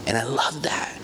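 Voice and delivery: whispered. Real, not acted. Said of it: "unvoiced aside".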